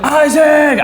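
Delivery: high, loud panicked cry of his name; frantic call. Retold or imitated. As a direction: call him like he might be dying. It was so dramatic.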